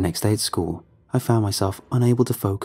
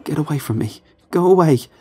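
rising to a loud high yell; panicked shout; 5 semitones higher, 5 dB louder than usual. Acted. Terrified boy screaming at the creature to go away. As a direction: push him away with your voice. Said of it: "I cried".

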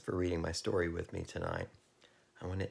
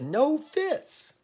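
high, loud, voiced searcher; calling out; 7 dB louder than usual. A narrator's reading. Searching in vain for the cat.

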